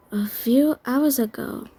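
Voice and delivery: ordinary delivery, nothing marked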